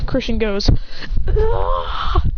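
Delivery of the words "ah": high mock fight yell; play-acted attacking cry, pitched way up; battle yell.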